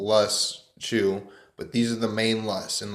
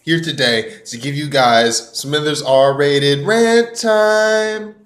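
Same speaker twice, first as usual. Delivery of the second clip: high, loud show-host opener; big booming hype-man welcome; hype intro; 6 semitones higher, 12 dB louder than usual. Acted. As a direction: pump up the crowd like a show announcer.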